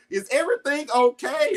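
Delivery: high, rising imitation of a worried mom; imitated concern. Mom asks while speaker cries.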